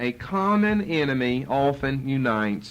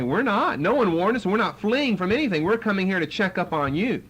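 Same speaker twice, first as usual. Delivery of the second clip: mock-indignant retort, pitched higher; playing the offended Pharisees answering back; 6 semitones higher than usual.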